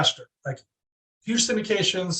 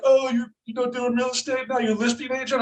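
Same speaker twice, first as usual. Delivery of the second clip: mocking friends; 5 semitones higher than usual. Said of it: "It's like".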